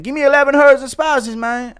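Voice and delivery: high, loud mock cornerman yell; corner kid impression. Cornerman kid yelling to his fighter. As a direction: shout advice from the corner like a hyped kid. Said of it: "impression of Chandler's kids in his corner".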